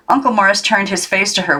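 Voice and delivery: plain reading voice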